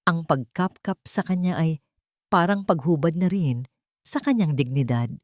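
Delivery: usual speaking voice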